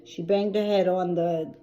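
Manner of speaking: normal talking voice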